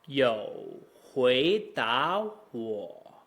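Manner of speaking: slow low "You", rising "hui da", low "wo"; stretched, exaggerated tone demo; model Mandarin tones. Shown as a demonstration. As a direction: draw out each syllable of "You hui da wo". Teacher models the phrase slowly.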